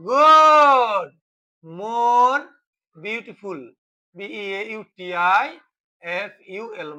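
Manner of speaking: one big, lingering cheer of approval; drawn-out praise. Real, not acted. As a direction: stretch it out to applaud the student's answer. Teacher praises students' answer.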